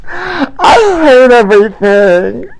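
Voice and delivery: high, slow, hammed-up crying wail; mock sobbing. Host wailing to his viewers about his missing t-shirts, in mock tears. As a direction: whimper it like a wounded drama queen. Fake despair over lost merch.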